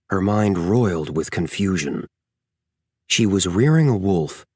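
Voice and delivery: ordinary delivery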